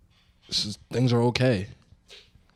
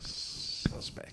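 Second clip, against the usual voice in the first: whisper; 11 dB quieter than usual. Real, not acted. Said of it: "Very sus".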